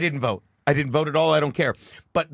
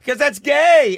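mocking buddy; 7 semitones higher, 7 dB louder, slower than usual. Brennan teasing quoted.

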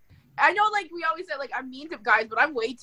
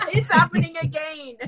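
laughing echo; 4 semitones higher, 3 dB louder than usual. Pretend. [name] echoes the fake orgasm line, laughing. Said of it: "faked one stone cold".

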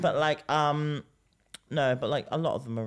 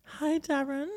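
put-on high girly voice; 3 dB louder, slower than usual. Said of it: "two-way conversation with yourself".

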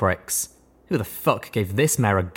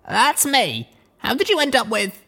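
Blackjack voice. A narrator's reading.

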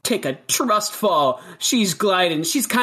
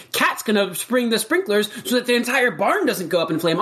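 ordinary talk, nothing marked

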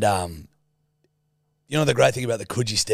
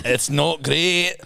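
slow, higher Scottish verdict; Scottish accent; 4 semitones higher, much slower than usual. Acted. Co-host judging the accent, slow Scottish. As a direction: draw it out in Scottish. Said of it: "give me your Scottish".

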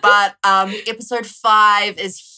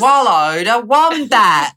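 mock Australian accent; 4 semitones higher, 9 dB louder, slower than usual. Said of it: "finishing accent attempt".

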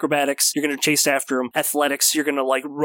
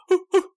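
aggressive hooting. Acted. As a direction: hoot at the creature like an angry owl. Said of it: "aggressively hooting".

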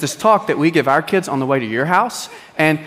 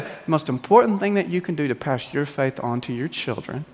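earnest elder advice; 6 semitones lower than usual. Retold or imitated. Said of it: "Mark said".

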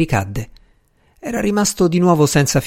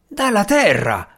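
slow, higher-pitched exasperation; angry retort; 7 semitones higher than usual. A narrator's reading. Newly arrived visitor answers a silly question, angrily. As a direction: draw the answer out in irritation. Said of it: "risposi con rabbia".

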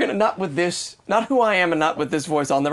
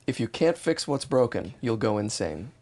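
slow, soft line reading sinking lower; slow model line; 6 semitones lower, 6 dB quieter, slower than usual. Shown as a demonstration. One more example for the imitator, slow and low. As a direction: say it slowly and quietly for copying. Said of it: "Give it one more".

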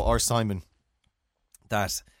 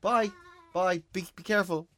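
parent's goodbye; 7 semitones higher than usual. Real, not acted.

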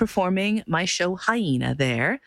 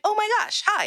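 excited greeting. Retold or imitated. Surprised greeting of a musician.

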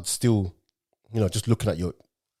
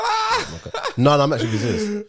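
laughing; 7 semitones higher, 5 dB louder than usual. Real, not acted. Joking protest, laugh heard.

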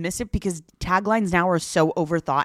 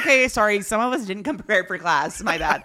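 mock apology; 3 semitones higher, 5 dB louder than usual. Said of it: "I was like".

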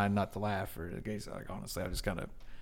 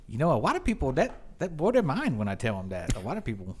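slightly higher, earnest clerk with w-like r's and l's; imitated cashier; 4 semitones higher than usual. Cashier bugged by customer's lack of reaction, imitated. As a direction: mimic a clerk let down by the muted response. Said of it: "he looks at me and he goes".

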